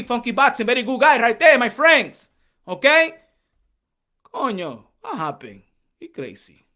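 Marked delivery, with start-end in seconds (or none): 6.0-6.6 whispered aside. Real, not acted. Muttered aside about fighter.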